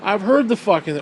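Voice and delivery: loud, fed-up retort; exasperated. Retold or imitated. Answering his friend, exasperated and loud. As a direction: snap back like you've heard it too often. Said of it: "I'm like".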